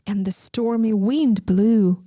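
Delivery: ordinary delivery, nothing marked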